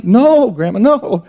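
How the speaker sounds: put-on high, protesting child's voice; little girl. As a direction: deny it like a giggly kid. Girl denies to grandma.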